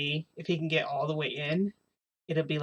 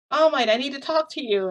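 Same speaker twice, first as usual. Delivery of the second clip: higher, louder call through a door; 8 semitones higher, 5 dB louder than usual. Retold or imitated. Midoriya calls to his teacher from outside, calling out. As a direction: imitate an urgent visitor calling in.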